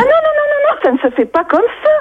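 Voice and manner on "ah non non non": high-pitched alarmed protest; much higher, insistent refusal; exasperated refusal. Real, not acted. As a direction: shoot it down, voice leaping up. Seller refusing absurd purchase.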